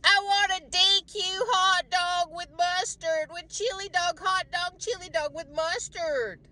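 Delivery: high, eager hot dog craving. Retold or imitated. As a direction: whine for a chili dog. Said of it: "on the way home I was like".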